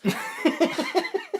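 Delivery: high burst of laughter; laughing. Real, not acted. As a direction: break into laughter. Laughing at own outrage.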